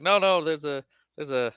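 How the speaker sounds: mock flustered, stumbling over restarts; frantic stammering. Han making up an excuse over the radio, mock frantic. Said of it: "kinda frantic and weird as possible".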